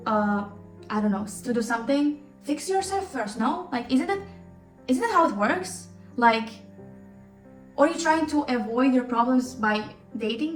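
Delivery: ordinary delivery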